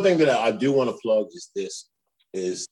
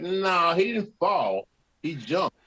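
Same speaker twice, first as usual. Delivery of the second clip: high-pitched, dropping in level on the last words; insistent contradiction; 8 semitones higher, slower than usual. Retold or imitated. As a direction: contradict it like a conspiracy theorist. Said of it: "I'm like".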